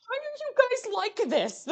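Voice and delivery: very high, strained wail of protest; mock exasperated squeal. Streamer teased by chat, mock exasperated squeal. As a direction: squeal it in comic despair.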